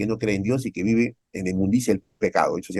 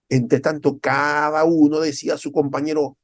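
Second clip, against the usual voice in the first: usual speaking voice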